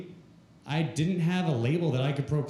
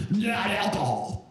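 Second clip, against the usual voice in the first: high, slow put-on wail; stand-in impression voice; 7 semitones higher, slower than usual. The ex correcting the narrator by text, high slow wailing voice. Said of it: "so that's the voice".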